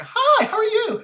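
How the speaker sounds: high, loud chirpy greeting; Starbucks mode. Retold or imitated. Mocking baristas greeting customers, chirpy. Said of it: "It's like Starbucks mode".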